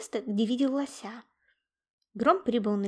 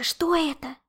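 high, urgent whisper; scared breathy question; frightened hiss; 5 semitones higher, 5 dB louder than usual. A narrator's reading. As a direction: whisper in alarm at a noise. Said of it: "испуганно прошипел Яша".

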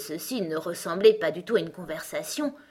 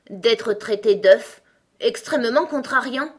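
affronted; 5 semitones higher, 8 dB louder than usual. A narrator's reading. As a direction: stretch it out, loudly put out. Offended egg complains about the insult. (ton vexé, contrarié)

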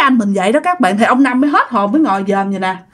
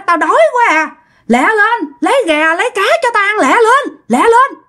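much higher, pleading-demanding cries; ông Bảy hollering; 9 semitones higher than usual. Acted. Hungry old man rushing whoever can feed him, high-pitched hollering. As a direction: keep hurrying them in a high, urgent voice. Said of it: "La làng lên vậy đó".

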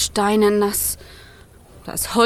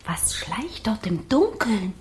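whisper; 4 semitones lower, 8 dB quieter than usual. Acted. Fire frightened in the dark.

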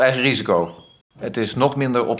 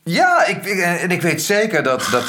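higher and louder, overwhelmed with emotion; emotional burst; 7 semitones higher, 5 dB louder than usual. Acted. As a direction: blurt it out, moved. Agrees, overwhelmed and moved. (opgewonden en ontroerd, hoger en luider)

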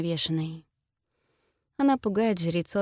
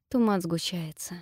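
plain reading voice

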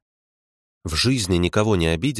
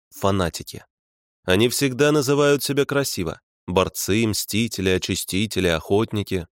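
ordinary delivery, nothing marked

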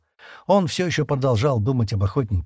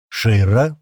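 louder call with a rising end; 6 dB louder than usual. A narrator's reading. Stan calls the silent, frightened Shira, louder call. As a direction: call her name to get an answer. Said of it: "окликнул её Стэн".

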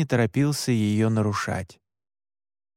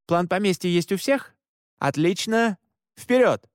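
higher, rousing team orders; brisk command; 5 semitones higher than usual. A narrator's reading. Leader sending hunters into the manor, brisk and commanding. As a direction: check they're ready, then send them off.

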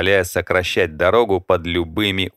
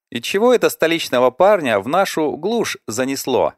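put-on higher young woman voice; curious girl, pitched up; 8 semitones higher than usual. A narrator's reading. Librarian voiced.

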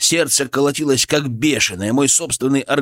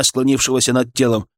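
ordinary delivery

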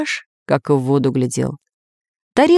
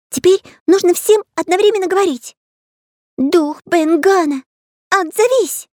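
high, keen character voice, slowing on the call; explaining artist; 13 semitones higher, 3 dB louder than usual. A narrator's reading. Artist shows friends the summoning, high instructive voice. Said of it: "объяснял Карандаш".

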